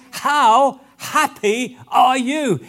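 loud, high, slowly drawn out; joyful exclamation. Shown as a demonstration. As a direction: savour each word with delight. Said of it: "That's what it means".

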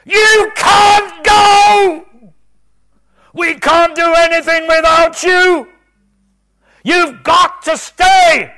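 very high, very loud, drawn-out shout; desperate scream. Retold or imitated. Preacher screaming at departing Jesus, desperate scream. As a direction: scream it as if he's leaving. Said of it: "I screamed at him".

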